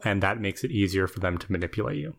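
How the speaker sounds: ordinary talk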